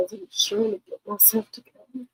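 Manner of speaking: quiet, trying to regain composure; hushed, struggling to pull together; emotional. Real, not acted. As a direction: softly hold back real emotion. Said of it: "emotional about a lot of stuff".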